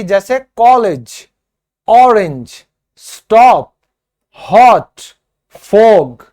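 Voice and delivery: emphatic teacher drill; short o demo. Shown as a demonstration. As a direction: punch the ऑ sound word by word. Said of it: "इसको ओ की आवाज आएगी".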